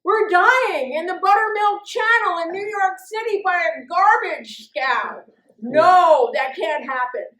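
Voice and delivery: high, loud panicked thought; inner panic. Retold or imitated. Waking to the horn and panicking, hammed-up loud and high. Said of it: "and my head went".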